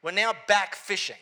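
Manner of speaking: loud, high-pitched pulpit preaching; full-force preaching. Acted. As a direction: preach it out to the whole room with fire.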